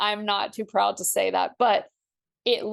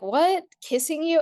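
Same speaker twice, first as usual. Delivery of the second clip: higher, incredulous squawk; mock disbelief; 7 semitones higher than usual. Retelling her old disgust at dog kisses, mock incredulous. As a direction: recoil at the very idea.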